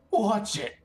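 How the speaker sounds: high, cracking adolescent sneer; Hebos voice crack. Acted. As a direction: let the voice jump and crack on a sneered warning. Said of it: "perfect time for a Hebos voice crack".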